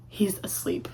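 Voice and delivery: breathy whispered aside, no voice. Retold or imitated. Whispering to viewers that the cute boy already sleeps. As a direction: whisper it like he might wake. Reporting the seatmate had fallen asleep.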